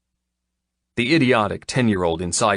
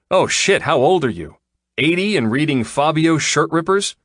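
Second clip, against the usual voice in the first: mocking disbelief; 8 semitones higher, 5 dB louder than usual. Man mocks her prim word.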